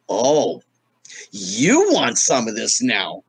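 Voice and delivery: slow, low taunting challenge; imitating Richard Pryor. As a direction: draw it out like a dare. Richard challenging him onstage.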